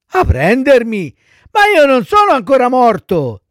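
high, loud startled protest; alarmed child puppet. Acted. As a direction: object in childish panic. Pinocchio protests to the undertakers. (burattino allarmato, voce acuta)

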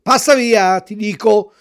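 the fisherman yelling the dog away again. A narrator's reading. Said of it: "gli ripeté il pescatore". (urlo minaccioso più forte)

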